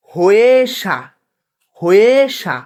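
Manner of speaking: "হয়েসা" drawn out, long e before a falling sa; pronunciation demo. Shown as a demonstration. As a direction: model "হয়েসা" slowly as one word. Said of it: "আমরা আবার উচ্চারণগুলা করি".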